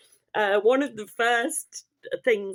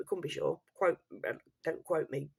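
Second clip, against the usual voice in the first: ordinary talk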